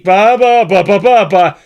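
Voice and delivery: loud playful ba ba stutter; tongue-tied babble. Pretend. Streamer to chat, mock tongue-tied loud babble. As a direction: belt out nonsense syllables, joking you can't talk. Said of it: "but I can't speak".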